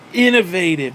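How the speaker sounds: ordinary delivery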